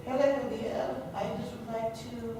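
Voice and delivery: hunched over, faint and frail; very soft old lady voice, hunched; hunched older lady. Shown as a demonstration. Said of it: "you might hunch over a little".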